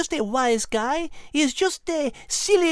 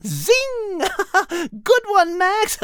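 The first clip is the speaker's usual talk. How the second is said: put-on French accent; 6 semitones higher, 5 dB louder than usual. French cat joking.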